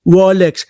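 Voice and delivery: Rolex said as "Wolex", R turned into W; mock a speaker who can't say R, Rolex becomes "Wolex". A vlogger mocking a politician who can't say R: Rolex as "Wolex". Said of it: "Ang bigkas ko sa Rolex".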